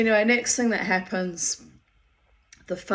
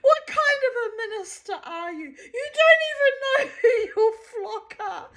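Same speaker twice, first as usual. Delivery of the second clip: mock tirade; 3 dB louder than usual. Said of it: "she launches into this tirade".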